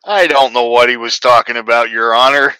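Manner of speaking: loud, innocent plea to a judge; hammed-up denial before the judge; mock defendant plea. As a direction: play it up for the courtroom.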